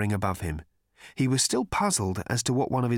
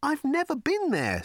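high protesting Arthur. Acted. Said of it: "said Arthur".

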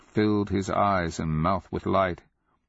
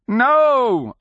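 high, loud shout of protest; loud drawn-out yell; anguished cry; 9 dB louder than usual. A narrator's reading. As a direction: cry out at full voice to stop him. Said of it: "Akamian cried".

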